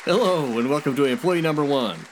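loud, bright host welcome; game show host. Acted. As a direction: announce it like a TV host.

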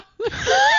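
high shriek of a laugh that cracks; loud shrieking laugh. Real, not acted. Laughing high at her own anger at Caleb. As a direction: burst out laughing in a high squeal.

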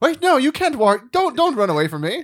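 high, loud call after someone; hammed-up plea to someone sneaking off; mock pleading. Calling after Ryan Reynolds as he sneaks away, mock pleading.

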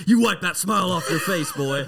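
loud, high imitation of a stern grandpa; mock chewing-out. Grandpa ordering a laughing boy, loud imitated scolding. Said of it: "chewed you out for oil".

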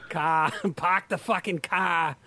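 put-on slow, drawn-out Boston accent, r's dropped; laughing Boston accent impression; mock Massachusetts accent. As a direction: ham up a Boston "cah". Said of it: "can't even do the Massachusetts accent".

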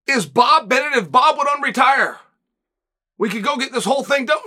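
ordinary talk

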